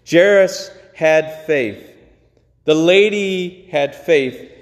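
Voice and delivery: slow, each word weighed; slow emphatic lines. Acted. Preacher driving the point home to the congregation, slow and emphatic. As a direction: lay it down slowly, word by word.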